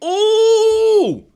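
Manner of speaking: sudden loud, very high ooh at a near miss; excited ooh. Real, not acted. Streamer watching the match, loud excited ooh. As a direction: yelp out at the near goal.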